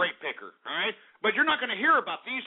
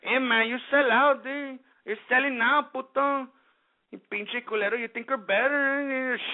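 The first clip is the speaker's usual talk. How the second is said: higher-pitched, exaggerated Mexican accent; mock Mexican accent; 4 semitones higher than usual. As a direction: imitate heckling in a put-on Mexican accent. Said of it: "what the Mexican community does".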